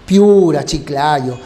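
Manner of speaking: ordinary delivery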